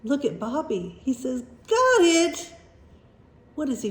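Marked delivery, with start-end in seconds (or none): 1.7-2.5 high, loud call of success; triumphant shout. A narrator's reading. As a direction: call out proudly on making the catch. Boy catches the runaway hat.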